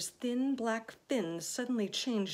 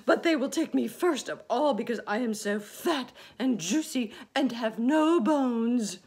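wailing; 5 semitones higher, 13 dB louder than usual. A narrator's reading. Earthworm wailing about being eaten. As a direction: moan it in self-pity. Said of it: "wailed the earthworm".

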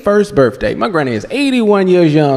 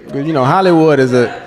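ordinary talk, nothing marked

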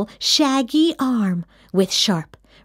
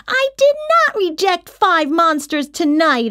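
higher, indignant protest; interrupting; 7 semitones higher than usual. A narrator's reading. Kid cutting off the monster's warning, protesting. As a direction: cut in sharply to protest. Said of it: "I interrupted".

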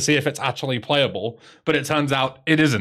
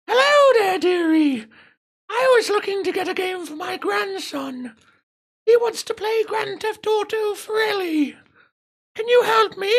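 put-on very high, falsetto sweet old granny; high quavering granny voice calling a shop; old lady voice. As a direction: put on a much higher falsetto dear old lady asking for help. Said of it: "That's my incredible old lady accent".

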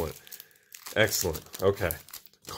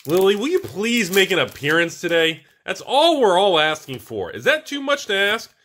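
higher, half-joking plea to the pack; pleading; 7 semitones higher, 4 dB louder than usual. Real, not acted. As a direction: beg the card to show up. Begging for a card to appear.

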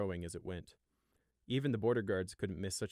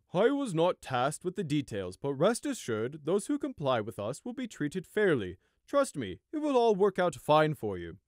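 higher, louder, slow and falsely reassuring officer; smug guard voice; 7 semitones higher, 7 dB louder, slower than usual. Acted. Border guard reassuring villagers: slow, smug. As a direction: lean into the hollow promise, slow and loud.

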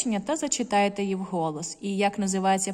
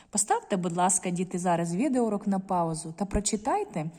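ordinary delivery

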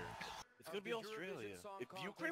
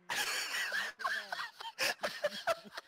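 high shrieking laugh; 5 dB louder than usual. Real, not acted.

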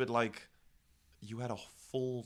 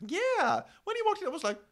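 high excited; 5 dB louder than usual. Real, not acted.

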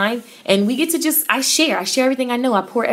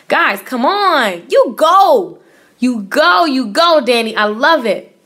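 cheering; 3 semitones higher, 4 dB louder, slower than usual. Real, not acted. Cheering a viewer's success.

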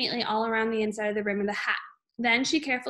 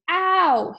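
sharp high yelp of pain; pained scream; 7 semitones higher, 7 dB louder than usual. A narrator's reading. Father screaming as his wife yanks the hat. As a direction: yelp as the glued hat is yanked. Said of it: "he screamed".

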